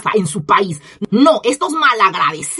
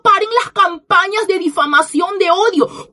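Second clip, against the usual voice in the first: mocking quote; 6 semitones higher than usual. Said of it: "y diciendo".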